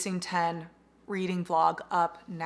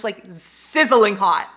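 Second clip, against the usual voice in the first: slow, louder swooning over the heat; gushing excitement; 9 dB louder, slower than usual. Real, not acted. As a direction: savor the words, swooning. Gushing about tension.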